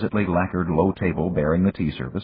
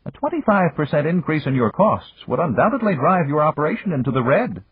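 put-on higher, measured voice of an old friend; Japanese magnate; 9 semitones higher than usual. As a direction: voice the older businessman, courteous and calm. Yamagata speaks.

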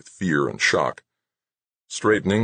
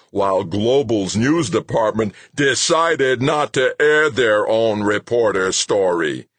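slow, raised, incredulous scorn; scathing; 5 semitones higher, 5 dB louder, slower than usual. A narrator's reading. Boss mocking his news department's decision, scathing. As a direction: spell out the blunder slowly, building in anger.

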